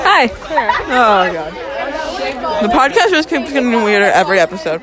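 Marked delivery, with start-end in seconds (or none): none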